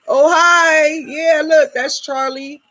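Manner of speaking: excited greeting. Real, not acted. Showing bird to viewers.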